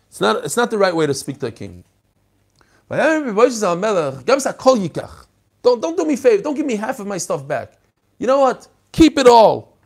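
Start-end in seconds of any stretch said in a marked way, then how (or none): 2.9-9.8 high, loud, offended refusal; indignant protest. Acted. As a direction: throw the gift back at the king. Mephibosheth's outburst continues.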